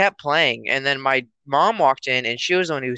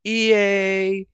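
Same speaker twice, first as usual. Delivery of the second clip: game intro voice; 6 semitones higher than usual. Retold or imitated. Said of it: "there's another one, and it was".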